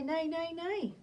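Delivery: lightly voiced neighing; whinny like a horse; a hint of a horse. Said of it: "They probably say".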